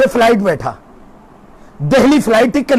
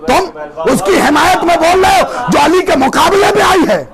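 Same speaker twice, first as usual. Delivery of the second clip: raised, shouted sermon voice; fiery preaching; 5 semitones higher than usual. Acted. Preacher rousing his congregation with a story, shouting.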